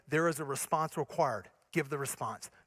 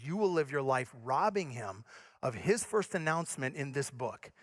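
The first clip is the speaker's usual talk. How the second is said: ordinary talk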